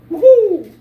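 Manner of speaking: high, loud woohoo; playful whoop. Real, not acted. Showing viewers the tinfoil hat, high whoop. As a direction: let out a high-pitched cheer.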